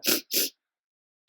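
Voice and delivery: soft sniffs of the sea air; audible sniff. Acted. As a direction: breathe in the sea smell. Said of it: "They stood sniffing".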